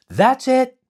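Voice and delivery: high, loud excited shout. Acted. Momotaro shouts to his crew as the island appears, loud. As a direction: yell it across the deck. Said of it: "he shouted".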